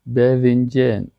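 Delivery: slow "باذنجان" with fronted e-like vowels, bedhinjen. Shown as a demonstration. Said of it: "ما شما باذنجان بگیم، اونا باذنجان".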